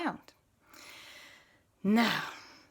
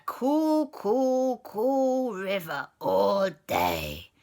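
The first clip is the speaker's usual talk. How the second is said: drawn out cool; 4 dB louder, much slower than usual. Acted. Gru drags out cool.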